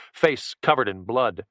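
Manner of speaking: ordinary delivery, nothing marked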